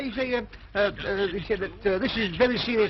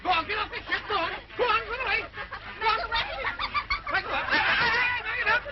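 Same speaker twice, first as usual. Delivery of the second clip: much higher, giggling as he shoos them; laughing high voice; 9 semitones higher, faster than usual. Acted. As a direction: chase them off between laughs, pitched way up. High laughing voice shooing people off.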